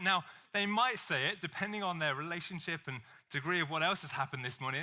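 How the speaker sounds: ordinary talk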